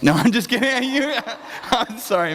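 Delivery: laughing apology, pitch jumping up. Real, not acted. Pastor apologizing for teasing someone in church, laughing. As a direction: talk through high laughter.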